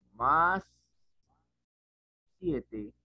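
ordinary talk

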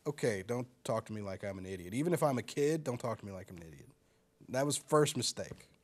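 ordinary delivery

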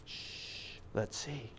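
very soft hushed whisper; shush, then murmur secretively; barely audible playful hush. Pretend. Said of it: "I'm gonna whisper it to you".

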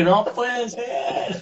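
slow, drawn-out words broken by laughter; laughing disbelief. Retold or imitated. Remembering the covid news, laughing in disbelief. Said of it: "y dije".

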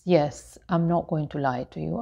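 usual speaking voice

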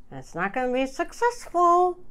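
starting to cry despite trying not to; snotty crying. Real, not acted. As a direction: voice breaking into tears, high and tight. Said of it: "I'm gonna try not to cry".

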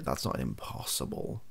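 usual speaking voice

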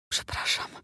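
ashamed whisper, breath only; guilty voiceless whisper. A narrator's reading. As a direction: whisper the apology, barely audible. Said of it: "wyszeptała".

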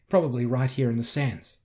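ordinary delivery, nothing marked

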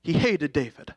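ordinary talk

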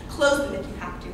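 normal talking voice

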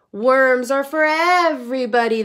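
loud, protesting cry. A narrator's reading. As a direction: protest all together. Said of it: "they cried".

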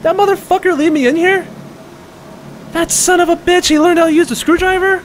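very high-pitched incredulous outcry; indignant disbelief. Real, not acted. Player cursing the character who left him, indignant disbelief. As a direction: squeal it in outraged disbelief.

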